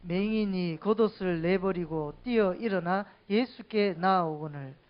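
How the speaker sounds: loud, projected verse reading; scripture read-aloud. Real, not acted. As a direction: lead the congregation in reading aloud. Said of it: "같이 읽겠습니다".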